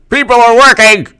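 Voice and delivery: high, loud hammed-up outcry; mock-appalled shriek; mock horror. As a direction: cry out in pretend horror. Acting horrified Democrats.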